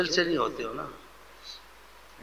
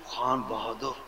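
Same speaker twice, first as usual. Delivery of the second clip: fawning flattery; 8 dB quieter, slower than usual. Retold or imitated. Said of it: "اس کو ایسا بولتے ہیں۔".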